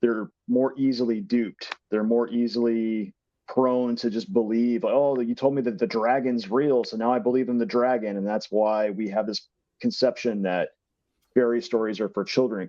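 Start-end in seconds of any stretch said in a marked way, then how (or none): none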